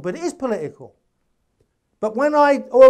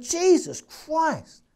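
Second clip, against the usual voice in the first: higher, very slow amazed "Jesus Christ"; amazed exclamation; 8 semitones higher, much slower than usual. Retold or imitated. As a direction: drag it out in disbelief. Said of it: "I'm going".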